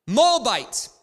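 one high, very loud mock yell; hammed-up shouted query from the crowd; shouted crowd question. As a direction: fire it out like a heckler. Imagined crowd Q and A heckler.

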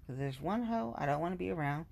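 usual speaking voice

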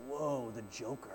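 awed whoa. Retold or imitated. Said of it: "we're just like".

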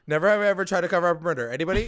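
fast, loud, high taunt; mock taunting game call. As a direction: rattle it off fast to provoke. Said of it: "Jughead's like".

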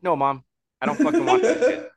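high, loud, laughing retort at mom; a teen refusing mom's junk, loud and high through a laugh; exasperated outburst. Retold or imitated. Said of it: "I'm like".

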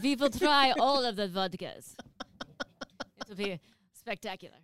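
cartoonish, badly faked Russian accent; mock Russian accent. Playing a vodka taster for her co-host, cartoon Russian accent. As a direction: start big in hammed-up Russian, then settle. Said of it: "sounds like a character from a cartoon".

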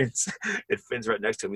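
ordinary talk

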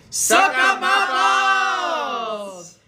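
slow, high comic shout. Pretend. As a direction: belt it out absurdly slow. Comic announcement climax.

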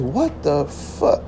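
slow, hushed what the fuck; stunned disbelief. Retold or imitated. Hearing the girls laugh on speakerphone, slow hushed disbelief. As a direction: let it crawl out, quiet and stunned.

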